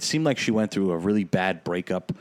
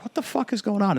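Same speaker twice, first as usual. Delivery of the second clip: startled; 12 semitones higher, faster than usual. Retold or imitated. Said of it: "I'm like".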